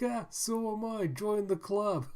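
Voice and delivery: a hungry mother joking it off. Pretend. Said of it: "She's like".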